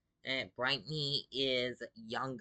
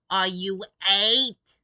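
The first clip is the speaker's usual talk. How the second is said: mocking, much louder and higher, slow and stretched; mocking loud shout; 8 semitones higher, 16 dB louder, slower than usual. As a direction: shout it slowly to ridicule them. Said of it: "had the gall to say".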